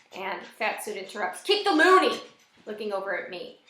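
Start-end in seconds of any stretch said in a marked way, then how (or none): 1.4-2.2 high, loud heckle; sudden scornful shout; shouted interjection. A narrator's reading. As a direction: butt in with a loud jeer. Heckler butts in on a negotiation.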